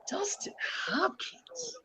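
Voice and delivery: whispered high "Dustin Hopkins?" rising; incredulous whisper. Real, not acted. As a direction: ask it in a high, slow whisper of disbelief. Repeats name in disbelief.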